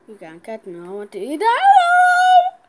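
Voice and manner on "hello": very high, loud hello; loud greeting shout. Real, not acted. Kid hollers hello at other players. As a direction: holler it across the map. Calling out in game.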